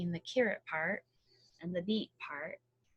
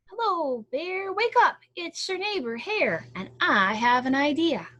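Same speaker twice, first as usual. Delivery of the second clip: high, loud, eager hare voice; a cheerful neighbour rousing a sleepy bear; eager hare calling; 6 semitones higher, 7 dB louder than usual. Acted. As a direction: call up brightly to wake the bear.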